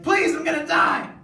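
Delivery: high, loud desperate shout; mock beg for your life, loud; loud, high hammed-up begging; hammed-up pleading. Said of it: "And then she's like".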